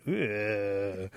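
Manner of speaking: drawn-out ugh of revulsion; disgusted groan. Acted. Animals hear of the bath in their water, disgusted groan. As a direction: groan as if grossed out.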